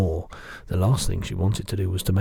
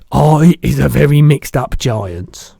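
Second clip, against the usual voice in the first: big, slow, booming giant voice; loud lumbering giant, higher and slow; the giant's voice; 6 semitones higher, 13 dB louder, slower than usual. Acted. Giant correcting the girl, big booming voice.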